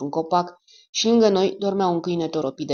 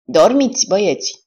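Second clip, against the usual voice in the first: louder, higher call to the men; 5 semitones higher, 8 dB louder than usual. Acted. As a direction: call the order across the room. Calling out goodnight to the men.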